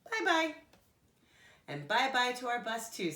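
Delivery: high furry monster voice; lightly voiced Grover, cheery. A narrator's reading. Grover's farewell in story.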